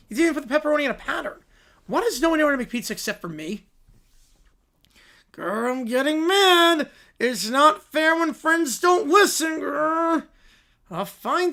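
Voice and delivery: put-on high childish voices, whiny and loud; squabbling kids. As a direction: kids fighting over who does the toppings. Kids squabble over pizza.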